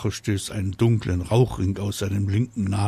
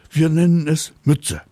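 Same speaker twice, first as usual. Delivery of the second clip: excited reveal; 8 semitones higher, 7 dB louder than usual. Acted. Papa excitedly reveals name.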